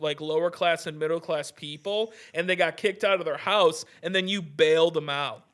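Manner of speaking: ordinary talk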